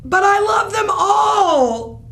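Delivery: high, slow, tearful shout of protest; a child wailing through tears; crying wail. Acted. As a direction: wail it as if the world is ending. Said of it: "Spencer cried".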